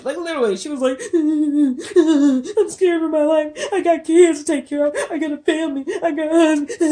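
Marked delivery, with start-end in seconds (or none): 1.1-6.8 high, whiny fake sobbing, no tears; sobbing put on for show, pitched up high; mock fake crying. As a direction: ham up a wailing victim act. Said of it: "How you crying with no tears?".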